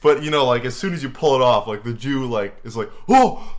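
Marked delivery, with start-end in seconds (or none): 3.1-3.6 high, loud mock gasp; exaggerated startled yelp. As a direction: cry out in sudden shock. Acted gasp as hat comes off.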